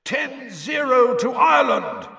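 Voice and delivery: high, drawn-out announcing shout; commentator's roar. A narrator's reading. Commentator announces Ireland's lead to the crowd, slow roar. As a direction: roar the score slowly across a stadium. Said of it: "roared Bagman".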